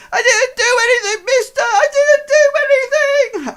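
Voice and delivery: put-on high, loud wailing boy; crying urchin. Seven-year-old urchin dangling by his collar, crying out high and loud. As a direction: wail your innocence like a caught child.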